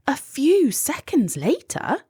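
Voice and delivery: plain reading voice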